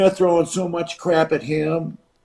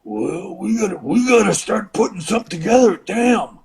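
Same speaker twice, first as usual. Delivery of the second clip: put-on higher voice, urgent; imitated wrestler; 4 semitones higher than usual. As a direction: imitate a worked-up wrestling partner. Impression of Savage reacting, retold.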